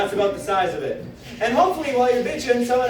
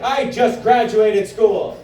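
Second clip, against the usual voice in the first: slow cheery brag; 4 dB louder, much slower than usual. Retold or imitated. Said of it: "I'll be like".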